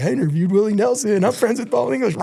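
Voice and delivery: jazzed up biker bragging while doing wheelies. Retold or imitated. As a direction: crow it like you just met your hero. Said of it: "'cause I'm so jazzed up".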